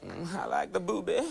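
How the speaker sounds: unsophisticated put-on politician voice; crude impression. Host doing crude impression for audience, silly put-on voice. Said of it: "least sophisticated impressions on television".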